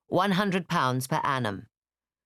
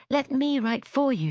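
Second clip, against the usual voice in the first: eager offer; 11 semitones higher than usual. Acted. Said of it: "said Jane".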